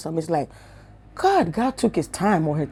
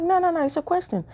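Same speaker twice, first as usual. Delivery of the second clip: reassuring officer; 11 semitones higher, 3 dB quieter than usual. Retold or imitated. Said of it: "He was like".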